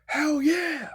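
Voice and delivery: high, thrilled hell yeah; excited cheer. Retold or imitated. Sister and brother-in-law cheering at the news, thrilled. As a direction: cheer it like a fan who just got great news. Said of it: "They were like".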